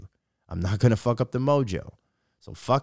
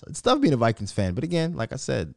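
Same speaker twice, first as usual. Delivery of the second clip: usual speaking voice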